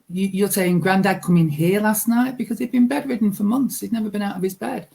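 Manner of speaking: ordinary talk, nothing marked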